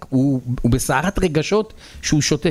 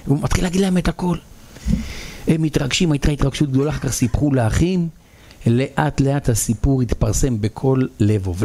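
usual speaking voice

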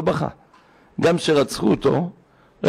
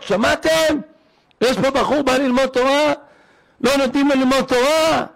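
imitated weeping rabbi; 10 semitones higher than usual. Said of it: "התחיל לבכות".

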